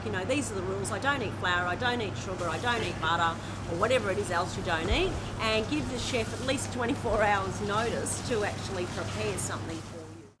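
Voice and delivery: ordinary talk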